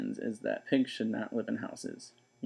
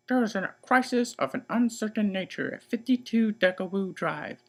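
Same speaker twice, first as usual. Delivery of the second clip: put-on 1 stern older sister; 10 semitones higher, 3 dB louder than usual. Eugenia voice.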